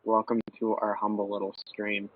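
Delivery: usual speaking voice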